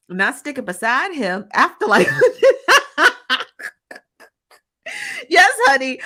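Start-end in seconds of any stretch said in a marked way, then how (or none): 1.5-6.0 shrieking with laughter, high and loud. Real, not acted. Streamer cracking up at a chat joke, loud high laugh. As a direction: squeal it out through laughter.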